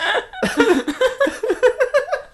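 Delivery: squealing high-pitched laughter; long high laugh. Real, not acted. Storyteller cracking up at own joke, squealing laugh. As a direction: break into helpless high laughter.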